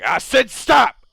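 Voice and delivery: loud, high, slow furious shout; angry shout. Acted. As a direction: bark him into silence. Other drunk cuts him off.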